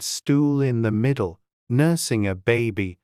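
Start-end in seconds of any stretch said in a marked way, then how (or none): none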